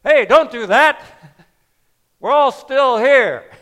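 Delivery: loud, high shout of warning; urgent shout. Retold or imitated. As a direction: yell out to stop him, retelling it. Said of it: "Paul shouted out".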